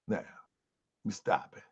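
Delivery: whispered aside to himself while pausing; breathy voiceless whisper off to the side. Real, not acted. Pauses the broadcast.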